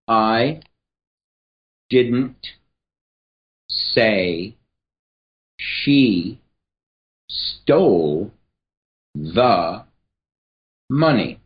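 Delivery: each word spaced out with long pauses; deliberate, word-by-word delivery; slow word by word. Shown as a demonstration. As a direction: lay the words down one at a time. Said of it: "I just spoke it very flat".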